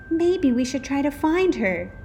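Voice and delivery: a hint of a concerned child; worried kids. A narrator's reading. Children wonder about their teacher.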